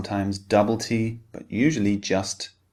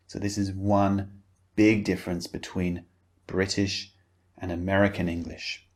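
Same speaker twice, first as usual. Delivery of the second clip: ordinary delivery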